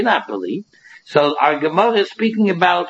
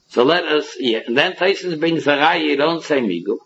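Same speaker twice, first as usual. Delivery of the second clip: ordinary talk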